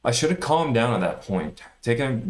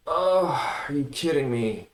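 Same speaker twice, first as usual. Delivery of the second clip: a high, stunned groan of disbelief; oh and the rest pitched up in dismay; can't believe he blew it; 6 semitones higher, slower than usual. Real, not acted. Reacting to losing his gains.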